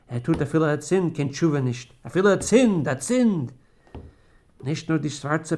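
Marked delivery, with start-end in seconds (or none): 2.0-3.5 loud, high cry over his many sins; anguished lament. Acted. Dying father laments his sins, loud and anguished. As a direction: wail out his guilt.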